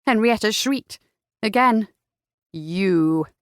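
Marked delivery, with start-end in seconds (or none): 2.5-3.3 low, seething accusation. A narrator's reading. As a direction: spit out the recognition through anger. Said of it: "Jack seethed".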